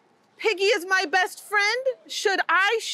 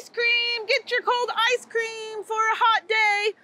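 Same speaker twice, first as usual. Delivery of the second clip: street vendor calling; 4 semitones higher than usual. Acted. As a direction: call out like an ice cream seller. Ice cream vendor calling out.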